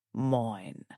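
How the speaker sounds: quiet possessive growl; a low-key possessive growl. A narrator's reading. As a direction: growl it softly. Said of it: "he growls".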